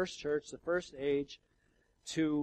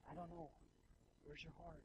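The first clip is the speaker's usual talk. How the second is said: hushed; 19 dB quieter than usual. Real, not acted. Pastor asking the congregation about the cross, hushed. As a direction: draw them in almost under the breath.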